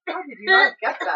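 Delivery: loud laughing disbelief. Real, not acted. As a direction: tease through laughter. Teasing the guesser.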